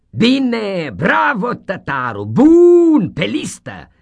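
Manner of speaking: high, loud, triumphant teacher crowing; loud gloating schoolmaster; gleeful schoolmaster shout. Acted. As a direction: crow it out like a pleased tyrant. Schoolmaster approving.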